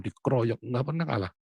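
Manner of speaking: normal talking voice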